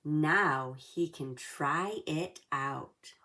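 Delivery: plain reading voice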